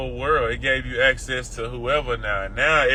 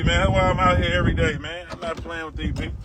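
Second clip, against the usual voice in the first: normal talking voice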